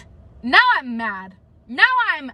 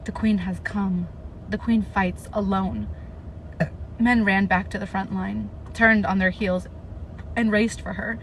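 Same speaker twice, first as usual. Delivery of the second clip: steady, even read-aloud of the battle scene; story narration; 7 semitones lower, 5 dB quieter than usual. A narrator's reading. Vlogger reading her novel aloud to viewers, even narration. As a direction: read the passage straight, like narrating a book.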